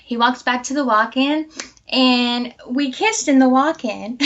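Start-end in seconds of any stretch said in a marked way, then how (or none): none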